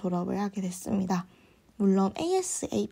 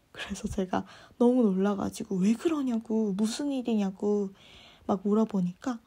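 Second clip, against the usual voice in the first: ordinary delivery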